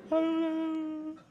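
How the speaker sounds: high-pitched imitation of a crying man; hammed-up wail, very high, dragging out the end; mock weeping. Preacher imitating tough friend bursting into tears, hammed-up high weeping. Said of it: "he starts crying and weeping, and he's going".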